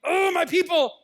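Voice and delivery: loud, high exasperated cry; esclamazione. Acted. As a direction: throw it out high and loud, fed up. Voicing God's exasperated love.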